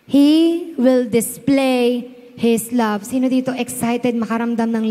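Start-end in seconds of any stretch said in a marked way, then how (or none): 0.1-3.0 very slow, louder, drawn-out declaration; solemn preacher proclamation; slow sermon proclamation. Acted. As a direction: unveil the point one word at a time. Repeats the point just as slowly.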